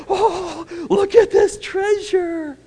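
high, slow squeaky thrilled dog voice; delighted dog. Pretend. Preacher voicing a dog finding food on the floor, squealing. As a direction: squeal over the find like a happy pup. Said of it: "They come in like".

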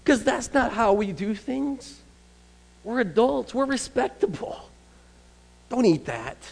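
mock prim. Preacher mocking adults too proud to eat off the floor, prissy. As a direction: sniff like a proud grown-up.

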